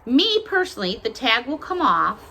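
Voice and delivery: ordinary delivery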